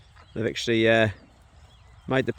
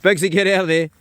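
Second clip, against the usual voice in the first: raised, higher-pitched call to a dog; 5 semitones higher, 6 dB louder than usual. Real, not acted. Owner ordering his dog out of the pile, calling out. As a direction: call the dog off sharply and loudly.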